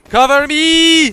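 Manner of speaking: high, slow drawn-out yell; cover me shout. Pretend. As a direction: bellow it like a battlefield order. Shouts the game radio command.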